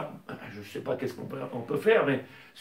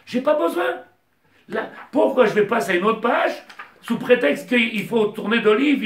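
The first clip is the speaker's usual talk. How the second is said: raised, louder sermon voice driving each question home; fervent preaching; 5 semitones higher, 7 dB louder than usual. Acted. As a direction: preach it to the congregation with fire. Rabbi preaching at full force.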